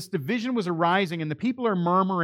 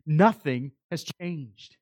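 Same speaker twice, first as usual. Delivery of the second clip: ordinary delivery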